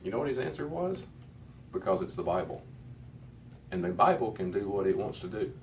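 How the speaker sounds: ordinary talk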